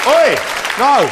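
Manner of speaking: short high shouts, loud and separate; calling out. Real, not acted. Landlord checking a rowdy crowd, calling out sharply.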